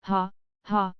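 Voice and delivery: understated, spoken-out ha ha; mild acted laugh. A narrator's reading. Pell responds to Weller's joke, mildly acted laugh. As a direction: read the laugh as two separate, measured words. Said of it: "laughed Mister Pell".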